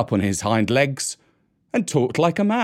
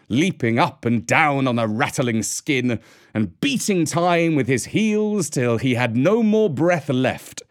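ordinary delivery

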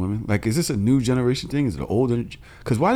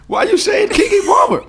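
very high, loud squawk of disbelief; incredulous outburst; 8 dB louder than usual. Real, not acted. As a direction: squeal the question in disbelief. Mock outrage at the name.

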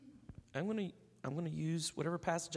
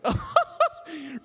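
embarrassed laugh; 12 semitones higher, 10 dB louder than usual. Retold or imitated. Realizing the shirt said repent.

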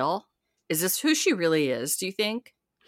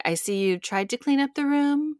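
higher, sweetly pointed remark; a lightly voiced mother-in-law's dig; mild mock politeness with a barb; passive aggressive; 8 semitones higher than usual. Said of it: "Just all passive aggressive".

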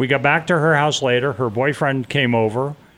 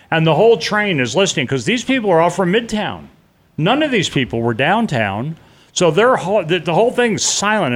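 normal talking voice

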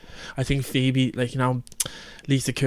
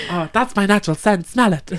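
higher, hammed-up sitcom quote; TV character impression; 7 semitones higher, 4 dB louder than usual. Trading favourite Friends lines, playful higher impression. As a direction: put on the character, pitched up.

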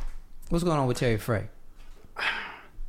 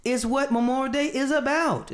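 high, slow, emphatic quote; mocking the writer's all-caps tweet, high and slow; all-caps reading; 10 semitones higher, slower than usual. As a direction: read it like it's typed in caps. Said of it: "all caps".

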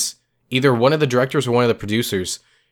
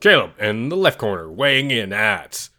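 booming fighting game announcer. Pretend. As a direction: stretch each weight out like a prizefight intro. Boxing-match introduction of two hosts.

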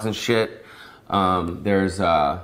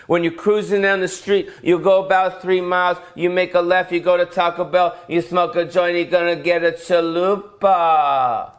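higher, laid-back stoner directions; stoner GPS voice; 5 semitones higher than usual. Retold or imitated. Stoner navigation voice guiding to Taco Bell, higher. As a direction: lounge through the route like a stoner. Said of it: "And I'll do the voice".

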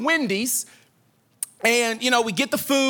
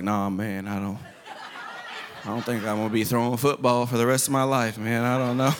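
much lower, softer, dejected dad voice; sulking dad; 14 semitones lower, 4 dB quieter than usual. Retold or imitated. Dad to kid asking about football, deep dejected mumble. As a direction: mope like a beaten-down father. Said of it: "He's like".

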